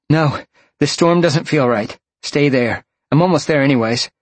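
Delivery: head him off firmly and urgently; urgent refusal. A narrator's reading. Roxy running, telling Sean to stay, urgently insistent.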